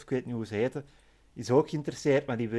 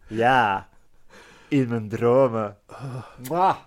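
smooth flirt voice; 2 semitones lower, 4 dB louder, slower than usual. Retold or imitated. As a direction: purr it like a charmer. Carlo's reply voiced.

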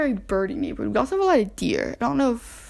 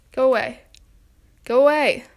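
louder each time, shooing the wasp off; shooing yell; 7 dB louder than usual. Real, not acted. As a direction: build from a firm shoo to a yell.

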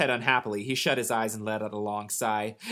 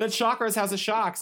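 excited repeat; 10 semitones higher than usual. Retold or imitated.

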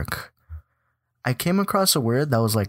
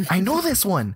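much higher, giddy squeal of recognition; excited recognition; 10 semitones higher than usual. Retold or imitated. Player retelling seeing a familiar name in the game, excited. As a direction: act out spotting a name you know.

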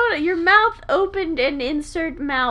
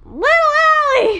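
yelling; 11 semitones higher, 8 dB louder, slower than usual. Real, not acted. Insisting on her show name.

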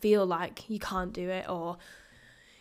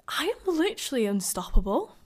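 amazed disbelief; 5 dB louder than usual. Retold or imitated. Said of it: "me running round Manchester being like".

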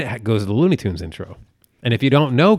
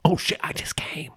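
slow, high urgent whisper; belated whisper; 6 semitones higher, 5 dB quieter than usual. Retold or imitated. Exhausted husband remembering the joke too late, urgent whisper. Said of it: "I just whispered in her ear".

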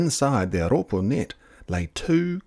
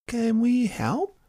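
put-on child voice; slower than usual. A narrator's reading. Child voice to the fairies.

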